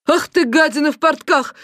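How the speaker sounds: high-pitched furious yell; outraged wail. A narrator's reading. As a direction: wail the insult at him. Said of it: "завопила соседка". (возмущённый вопль)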